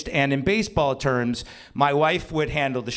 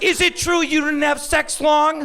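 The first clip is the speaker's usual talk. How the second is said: high-pitched kid interrogation, imitated; mock-indignant high voice; accusing son; 13 semitones higher, 4 dB louder than usual. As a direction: demand an answer in an aggrieved child's voice.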